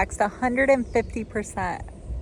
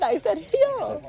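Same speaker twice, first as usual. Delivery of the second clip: hill said slowly and higher; defensive high hill; 7 semitones higher, slower than usual. Real, not acted. Protesting she said hill right, higher and slow. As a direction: insist you said hill. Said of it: "I did".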